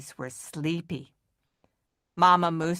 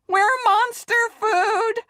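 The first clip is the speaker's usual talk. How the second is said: much higher, louder, slower panicked wail; terrified scream; 5 dB louder than usual. Acted. Murphy screaming at the approaching shape. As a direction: wail like you're about to be eaten. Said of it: "Murphy screamed".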